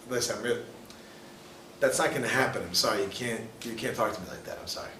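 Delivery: low, soft and firm; calm firm boundary. Retold or imitated. To a spouse who insulted you, calmly firm. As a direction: set the line quietly, no heat.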